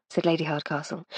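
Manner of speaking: ordinary delivery, nothing marked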